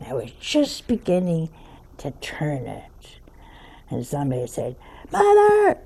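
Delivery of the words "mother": high, loud call across the room. Retold or imitated. Sister calling Mother from afar, loud high call. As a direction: yell her name to get her attention.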